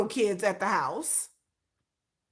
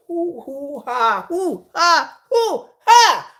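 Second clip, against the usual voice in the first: mock panting; 3 semitones higher, 5 dB louder, much slower than usual. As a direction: pretend to gasp for breath, growing. Mock hyperventilating at comment.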